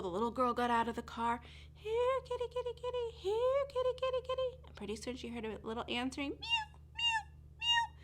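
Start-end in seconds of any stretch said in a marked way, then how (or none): 1.7-4.7 much higher sing-song call; calling the kitty. Acted. Girl calling out for the kitten. 6.3-7.9 tiny, very high kitten mew. Acted.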